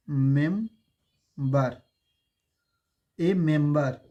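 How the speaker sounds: Member stretched out very slowly; teacher modelling the word member very slowly; drawn-out demo. Shown as a demonstration. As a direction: draw out member syllable by syllable. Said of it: "अगर हम कहें ए".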